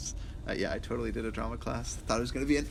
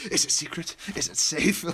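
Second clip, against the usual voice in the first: high, louder manic whisper-like quote; deranged Gandalf; 4 semitones higher, 5 dB louder than usual. Retold or imitated. Coming home to Maddie with a manic Gandalf voice. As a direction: play it psychotic and manic. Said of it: "like, all, deranged and, like, psychotic".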